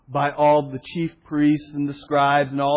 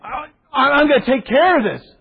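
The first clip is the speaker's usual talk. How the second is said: higher, louder, eager; eager Peter; 8 semitones higher, 5 dB louder than usual. Retold or imitated. Peter charging in to take care of it, higher and louder. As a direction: charge in to fix it.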